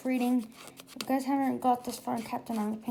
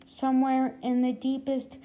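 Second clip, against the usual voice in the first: ordinary delivery, nothing marked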